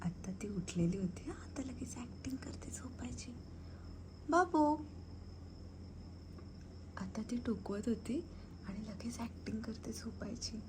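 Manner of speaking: soft whisper so the pet keeps sleeping; whispering so the pet won't hear. Real, not acted. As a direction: hush it, barely breathing. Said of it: "म्हणून मी हळू बोलत होते".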